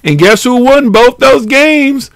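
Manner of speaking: high loud teasing build-up; excited. Real, not acted. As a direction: hype the question. Excited sports brag.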